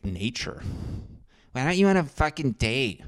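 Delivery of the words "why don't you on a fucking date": higher put-on voice of a nagging girl; mocking girl voice. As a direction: needle him in a mocking girl voice. Imagined girl nagging him.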